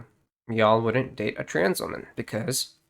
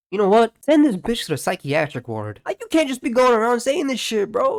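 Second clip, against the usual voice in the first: louder, higher angry rant; ranting yell; 10 semitones higher, 5 dB louder than usual. Real, not acted. Vlogger ranting at the harasser, louder angry yell. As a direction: let it rip at them.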